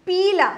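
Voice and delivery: पीला with a long first ee; पीला, loud with held ee; long vowel. Shown as a demonstration. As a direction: stretch the ee in पीला. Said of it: "उस सुर को लंबा खींचेंगे".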